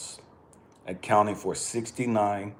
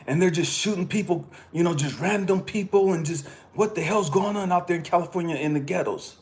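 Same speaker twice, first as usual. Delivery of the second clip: higher, excited mock New Yorker; imitating the gym guy; 9 semitones higher than usual. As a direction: play the gym guy going on about California. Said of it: "He said".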